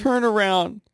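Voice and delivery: high playful order; mock command. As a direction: tell it to turn around, teasing. Mocking command to Meerkat.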